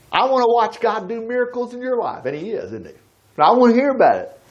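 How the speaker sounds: ordinary talk, nothing marked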